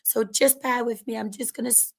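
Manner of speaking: ordinary talk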